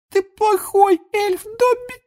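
slow, very high elf wail; anguished high-pitched house-elf; moaning Winky. A narrator's reading. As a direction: moan the reproach in a tiny squeak. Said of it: "простонала Винки".

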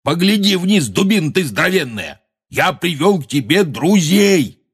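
high, insistent hollering; exasperated shouting. A narrator's reading. Hagrid urging the giant to look down, shouting. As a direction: shout to make a dim giant understand. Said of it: "крикнул Хагрид".

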